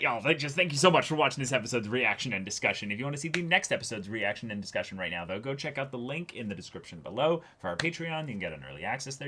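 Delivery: fast, low-key channel outro; outro plug read. Acted. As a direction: rattle off the Patreon plug.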